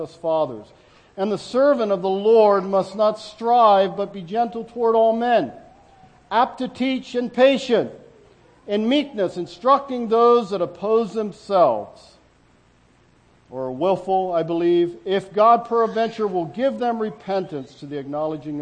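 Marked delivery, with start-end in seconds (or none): 1.2-12.2 slow, louder reading of the verse; scripture reading. Acted. Reads scripture aloud. 15.0-18.6 firm verse read from the pulpit; scripture reading. Acted. Reads scripture aloud.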